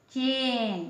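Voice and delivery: चेन with a long, held ए; ए की मात्रा का उच्चारण. Shown as a demonstration. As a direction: draw out the ए in चेन. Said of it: "ए निकला". (चेन में ए को खींचकर)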